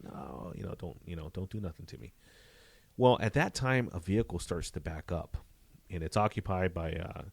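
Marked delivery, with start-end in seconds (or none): 0.0-2.1 retold plea. Officer imitates woman ducking from carjacker, quietly pleading. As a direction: softly voice someone begging to be spared.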